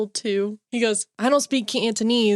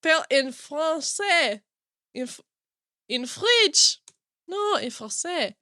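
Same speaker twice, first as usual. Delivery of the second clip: put-on higher, deliberate French accent; 5 semitones higher than usual. Host searching for a word, mock French accent. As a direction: dictate it in a hammy French accent. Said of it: "I started with this French accent".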